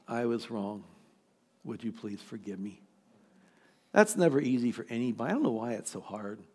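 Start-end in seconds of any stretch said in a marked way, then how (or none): 0.1-2.8 humble apology. Retold or imitated. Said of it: "the first to say".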